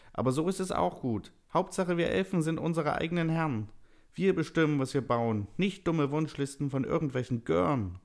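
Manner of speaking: very high elf voice, indignant; defiant elf. A narrator's reading. Villain elf in a story.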